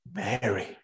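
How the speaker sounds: soft, low, tender naming. A narrator's reading. Jesus gently calling the grieving Mary by name, soft and tender. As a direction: say her name quietly, full of love.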